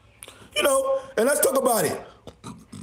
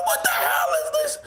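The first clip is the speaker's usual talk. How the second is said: high shouted disbelief; squealed what the hell. Retold or imitated. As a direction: shriek the question. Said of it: "I said".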